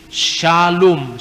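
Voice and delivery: "Shalom" with a clear sh at the start and a long O; shin sound. Shown as a demonstration. Lecturer showing the Hebrew shin sound, pronunciation demo. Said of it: "shin bukan sin".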